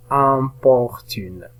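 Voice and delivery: "Importune" slowly, syllable by syllable; slow sounded-out "Importune"; syllables joined slowly. Shown as a demonstration. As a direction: stretch "Importune" into im, por, tune. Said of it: "nous avons la première syllabe".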